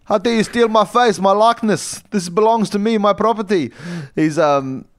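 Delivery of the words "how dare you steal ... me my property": high, loud indignant rant; outraged Elon Musk. Acted. Elon Musk ranting at the masked thieves, high and outraged. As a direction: squeal with wounded pride over your face.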